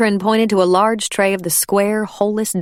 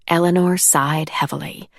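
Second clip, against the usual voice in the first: ordinary delivery, nothing marked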